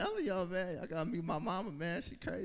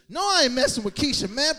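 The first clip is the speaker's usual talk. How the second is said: exasperated; 11 semitones higher, 15 dB louder than usual. Acted. Annoyed reply to the comment.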